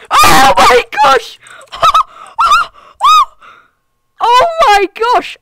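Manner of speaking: very high, loud, slow screaming joy; real triumphant screech; ecstatic shout. Real, not acted. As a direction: scream it out with a shrieking laugh. Sudden success shout and laugh.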